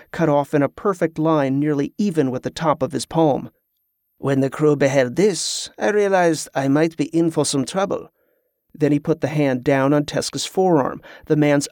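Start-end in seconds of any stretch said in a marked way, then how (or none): 4.2-8.1 French pirate. Acted. Pirate shows Tezca his maimed hand, pointed French accent.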